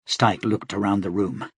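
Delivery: ordinary delivery, nothing marked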